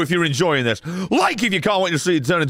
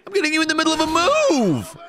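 indignant yell; 11 semitones higher, faster than usual. Real, not acted. Yelling at the game enemy.

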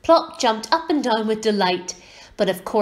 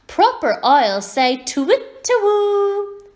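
high voice drawn out into a hoot; slow down and hoot the last word high; know-it-all little girl; 11 semitones higher, 6 dB louder, slower than usual. A narrator's reading. Little girl teaches owlet what owls say, hooting high.

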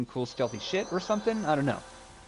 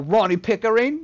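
higher, louder mock shout of the name; hammed-up retort; imitated reply; 9 semitones higher, 5 dB louder than usual. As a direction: yell your own name back defiantly. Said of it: "And he's all".

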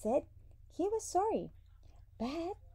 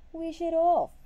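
high, loud plea; begging; 7 semitones higher, 11 dB louder than usual. A narrator's reading. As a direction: beg him at the top of the range. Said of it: "begged his wife".

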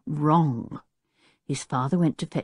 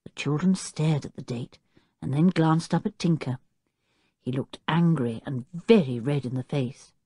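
usual speaking voice

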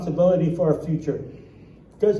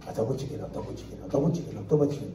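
mock grumbling; 3 semitones lower, 5 dB quieter, much faster than usual. Joke character moans over same lunch.

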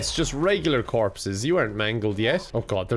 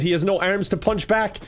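ordinary talk, nothing marked

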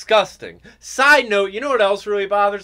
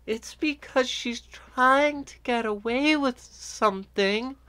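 high, slow, put-on sweet woman's voice; mock innocent feminine voice; fake girly voice; 7 semitones higher, 3 dB quieter, slower than usual. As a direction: coo it like a woman sweet-talking a cop. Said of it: "such a fake, contrived voice".

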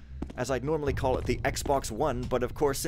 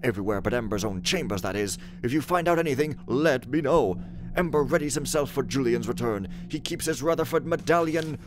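raised, loud theatrical reading voice; dramatic letter reading; 3 semitones higher, 5 dB louder than usual. Acted. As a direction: read the letter like a stage messenger. Reads in-game letter and text dramatically.

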